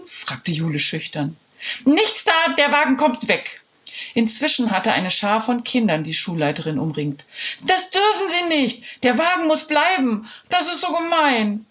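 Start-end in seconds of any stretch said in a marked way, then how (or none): 1.9-3.6 high, curt headmistress voice; stern principal. Acted. Principal refusing the children, high and curt. 7.6-11.6 put-on high, pleading kids' voices; protesting children. Children begging the principal, high and pleading.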